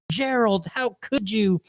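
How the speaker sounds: wife confronting her cheating husband; hurt wife. Retold or imitated. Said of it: "gonna make a name up".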